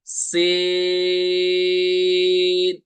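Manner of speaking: "सीन" held long, with a hissing whistle; long whistled sin. Shown as a demonstration. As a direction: stretch "सीन" for three counts and let it whistle. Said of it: "इनको हम तीन अलिफ तक खींचेंगे". ("सीन" को सीटी के साथ तीन अलिफ खींचें)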